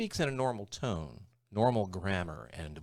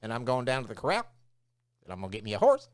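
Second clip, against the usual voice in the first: Texas twang, "corral" and "horse" rising; upward sentence endings; 5 dB louder, faster than usual. Shown as a demonstration. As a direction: lift "corral" and "horse" like questions. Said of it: "go up at the end of every sentence".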